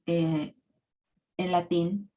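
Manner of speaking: usual speaking voice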